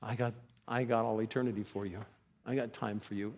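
low, soft, tender reassurance; tender Jesus. Retold or imitated. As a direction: gently promise him your time. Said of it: "He says".

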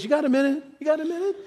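high, pestering mock voice; pestering asker. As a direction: mimic someone nagging for attention. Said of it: "somebody says".

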